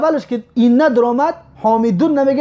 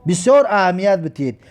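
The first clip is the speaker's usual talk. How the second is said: usual speaking voice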